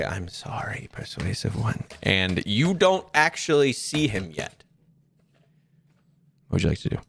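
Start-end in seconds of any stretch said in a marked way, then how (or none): 0.0-1.8 quieter, low creepy monster. Acted. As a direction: murmur it with menace. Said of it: "You hear him say".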